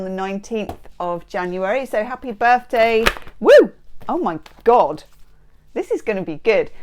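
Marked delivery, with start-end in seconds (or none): 3.4-3.7 short, high, loud woo; excited whoop. Real, not acted. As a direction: let out a delighted whoop.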